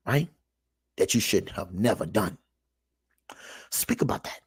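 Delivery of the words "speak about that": hushed aside, no voice; whisper. Real, not acted. Preacher to his viewers, a hushed whisper. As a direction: whisper it under the breath.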